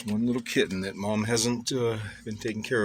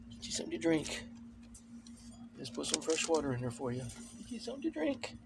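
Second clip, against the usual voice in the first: pet talk; 4 semitones higher, 9 dB quieter than usual. Real, not acted. Soft high talk to cat.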